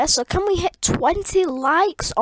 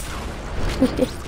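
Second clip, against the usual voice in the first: chuckling; 5 dB quieter than usual. Real, not acted. Laughing after kill.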